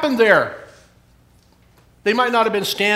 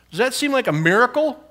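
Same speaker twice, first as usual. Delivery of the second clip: ordinary talk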